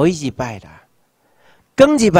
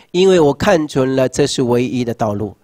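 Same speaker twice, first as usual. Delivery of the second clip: ordinary talk, nothing marked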